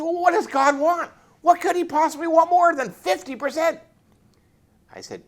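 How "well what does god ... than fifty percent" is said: high, loud, incredulous protest; indignant disbelief. Retold or imitated. Sergeant protesting that fifty percent should be enough, high and incredulous. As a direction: throw up your hands in disbelief.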